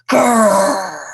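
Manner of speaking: loud drawn-out "grrr"; big pretend growl; playful tiger growl. Raccoon imitates tiger growl.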